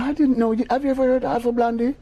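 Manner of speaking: high, lilting British lady voice. Retold or imitated. A British lady asking [name] about [name], high and lilting.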